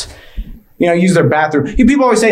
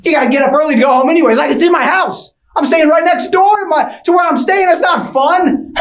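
put-on whiny kid; 9 semitones higher than usual. Whiny kid complaining about sleepover.

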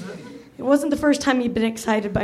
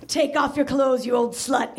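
grunted. Acted. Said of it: "he grunted".

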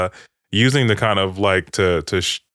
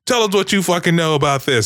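higher-pitched retold demand; mock interrogation demand; 8 semitones higher than usual. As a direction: lightly act out an interrogator's demand.

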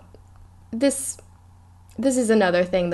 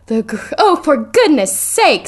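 louder, slow for goodness sake; loud exasperated; 6 semitones higher, 6 dB louder than usual. A narrator's reading. Reading Hermione interrupting Trelawney, loud and exasperated. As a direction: let it burst out, exasperated. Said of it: "says Hermione loudly".

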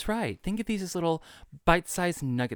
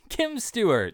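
grand introduction; 10 semitones higher, 5 dB louder, slower than usual. Pretend. Grand announcement of co-host.

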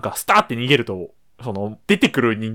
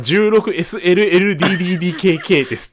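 laughing mock answer; 5 semitones higher than usual. Jokingly voicing the salesman's reply.

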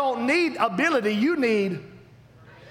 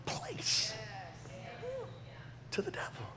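solemn whisper; 3 semitones lower, 9 dB quieter than usual. Acted. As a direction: let the warning sink into a hush. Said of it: "Jesus said".

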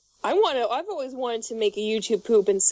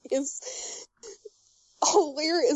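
ordinary delivery

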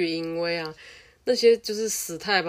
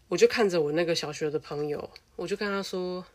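normal talking voice

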